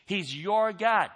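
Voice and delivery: ordinary talk, nothing marked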